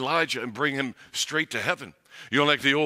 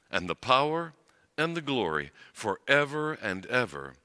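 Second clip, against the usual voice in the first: steady, hushed liturgical recitation; reciting the Lord's Prayer; 4 semitones lower than usual. Acted. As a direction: pray it together in a measured rhythm.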